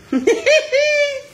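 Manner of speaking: high bursting laugh; big high laugh; loud high laugh. Real, not acted.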